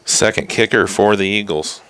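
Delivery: hushed whispered commentary; golf announcer whisper. Shown as a demonstration. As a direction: breathe it like a golf broadcast. Whispered golf-style call.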